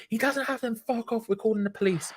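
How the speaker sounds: high-pitched imitation of an irate mum; retold mum's warning. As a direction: voice a mum seeing off a threat. Mum warning off the knife man.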